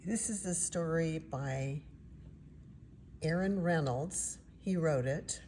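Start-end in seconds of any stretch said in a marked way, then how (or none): none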